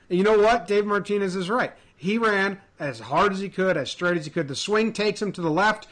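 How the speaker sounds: usual speaking voice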